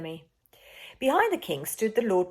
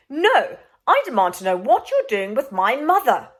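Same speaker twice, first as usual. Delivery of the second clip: angry demand; 5 semitones higher, 9 dB louder than usual. Acted. Boy demands answers.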